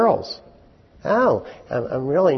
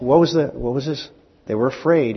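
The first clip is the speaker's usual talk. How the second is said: ordinary talk, nothing marked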